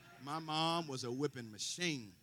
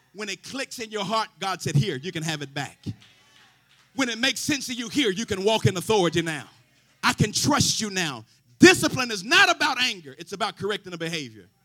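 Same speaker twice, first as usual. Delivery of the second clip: loud rising preacher's cadence, half sung; a sermon building to a roar; preaching at full force; 2 semitones higher, 15 dB louder than usual. Acted. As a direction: preach it out to the congregation.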